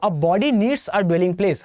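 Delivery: very slow, word by word; slow mnemonic. Shown as a demonstration. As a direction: spell it out slowly for students. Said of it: "abode को ऐसे याद रखो". (धीरे-धीरे एक-एक शब्द पर ज़ोर)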